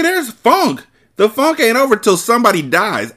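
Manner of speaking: high, loud incredulous rant; exasperated rant. Real, not acted. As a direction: rant at the story in disbelief. Reader rants about the plot.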